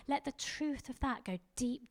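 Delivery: ordinary talk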